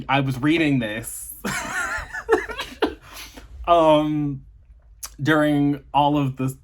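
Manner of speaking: cracking up mid-sentence; helpless giggles breaking off the words; laughing. Real, not acted. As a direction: try to talk, then break into laughter. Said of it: "I don't know why I'm laughing".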